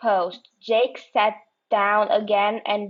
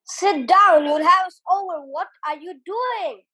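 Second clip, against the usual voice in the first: shouting; 7 semitones higher, faster than usual. Acted. Giles shouting at Kelly as the canoe rocks. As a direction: bark at her to sit down. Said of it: "He was shouting at Kelly".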